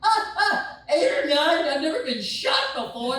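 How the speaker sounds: very high, loud strained gasping answer; pained yelp. Retold or imitated. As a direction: squeal out the pain score. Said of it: "I'm like".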